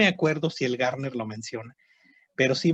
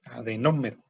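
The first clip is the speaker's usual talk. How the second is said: adenómero, lower and falling, NÓ accented; stress on first O; 4 semitones lower than usual. Shown as a demonstration. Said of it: "con acento en la".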